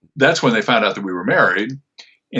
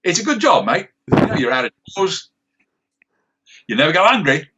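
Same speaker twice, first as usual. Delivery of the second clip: put-on higher, laid-back Australian accent as the gruff sniper; character Australian accent, pitched up and dry; Sniper's Aussie voice; 6 semitones higher than usual. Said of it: "to do the Aussie voice".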